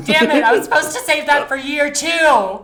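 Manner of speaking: loud, high, laughing through the words; giggling, loud and high; laughing outburst. Real, not acted. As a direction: crack up while you blurt it out. Laughing at own joke.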